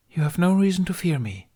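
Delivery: gently calm her fears; gentle. Acted. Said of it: "she said gently".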